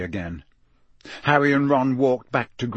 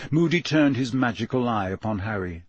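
ordinary delivery, nothing marked

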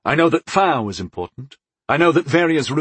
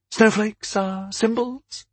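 high, slow questioning lilt; dubious goddess, slow and high; skeptical; 10 semitones higher, slower than usual. A narrator's reading. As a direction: stretch it out, pitched up in doubt.

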